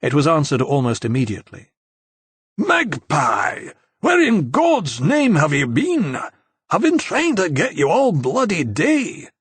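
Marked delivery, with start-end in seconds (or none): 2.6-9.3 exasperated Scottish call. A narrator's reading. Simon answers exasperated.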